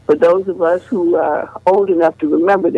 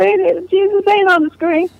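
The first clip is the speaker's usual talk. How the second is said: much higher, teasing, almost laughing; a kid poking fun at her mom; teasing teenage girl; 13 semitones higher than usual. Retold or imitated. Teen daughters laughing at mother.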